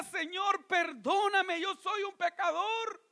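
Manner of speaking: high, slow, loud plea; a sinner at the temple begging God for pardon, loud and slow; pleading for mercy. Acted. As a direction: cry out begging forgiveness. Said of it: "ni siquiera se atrevía a levantar su mirada".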